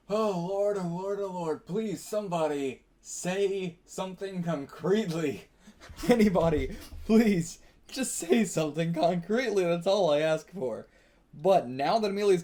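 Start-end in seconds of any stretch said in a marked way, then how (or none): 0.1-5.4 mock desperate plea. Pleading to the story's characters. 5.8-10.9 mock exasperated plea. Louder pleading to the characters.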